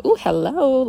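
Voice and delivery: high, loud playful ooh to her reflection; flirty greeting to mirror. Retold or imitated. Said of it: "I just go".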